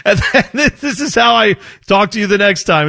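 high, laughing through the words; giggling as he speaks. Real, not acted. Teasing his co-host while laughing.

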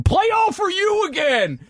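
put-on high, drawn-out drunk heckle; hammed-up drunk demand; drunken yelling. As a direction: yell it like a drunk superfan. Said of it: "gets drunk and just starts yelling".

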